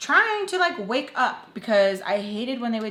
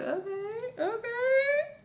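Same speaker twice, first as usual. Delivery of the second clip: much higher, light and pleased; high chirpy okay; much slower than usual. Retold or imitated. Telling herself all is good, high and light. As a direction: sing it up, everything's fine. Cheerful self-quote after a good day.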